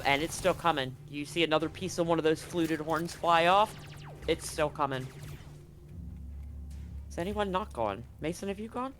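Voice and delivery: ordinary delivery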